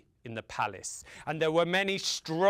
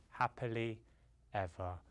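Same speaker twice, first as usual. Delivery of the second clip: hushed story ending; 8 semitones lower, 11 dB quieter than usual. A narrator's reading. Storyteller closing the crows' tale, fading to a whisper. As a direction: let each word sink softer and lower.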